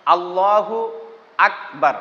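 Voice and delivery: আল্লাহু আকবার with a doubled l and a clean k; correct takbir. Shown as a demonstration. Teacher demonstrates the takbir's proper sound. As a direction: say আল্লাহু আকবার carefully, hard k. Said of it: "আমি বারবার আপনাদেরকে কিন্তু বলার চেষ্টা করতেছি".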